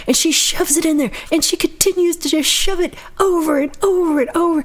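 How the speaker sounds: high, strained exasperation; hammed-up high-voiced disbelief; exasperated retelling. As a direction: pitch way up in comic exasperation. Exasperated retelling of bag being shoved.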